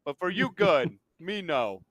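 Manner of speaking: hesitant comic voice, lightly done; Brian Regan impression. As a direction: lightly mimic a fumbling comedian. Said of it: "Brian Regan, too".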